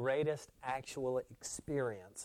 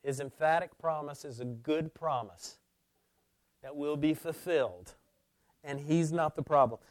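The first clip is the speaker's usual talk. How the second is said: ordinary delivery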